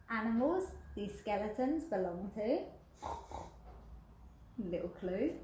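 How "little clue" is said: usual speaking voice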